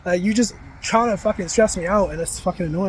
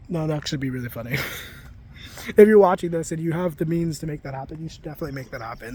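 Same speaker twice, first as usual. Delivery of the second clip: ordinary delivery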